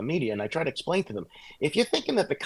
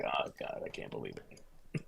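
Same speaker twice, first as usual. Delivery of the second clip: very low, barely voiced aside; hushed disbelief; 19 dB quieter, slower than usual. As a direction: trail down to a slow near-whisper. Acts hushed disbelief at a sight.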